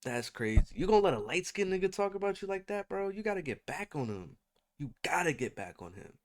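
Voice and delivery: normal talking voice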